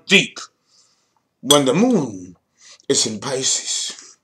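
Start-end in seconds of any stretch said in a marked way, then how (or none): none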